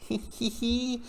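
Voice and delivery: high, imitated tittering; guilty giggle. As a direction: put on a little guilty tee-hee. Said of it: "like, a little guilty".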